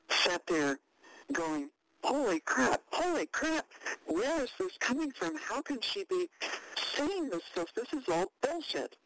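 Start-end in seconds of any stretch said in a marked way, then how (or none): 2.0-9.0 higher-pitched, incredulous inner voice; aghast, thinking to yourself; retold disbelief. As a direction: replay your stunned reaction, pitched up. Retelling own stunned inner reaction.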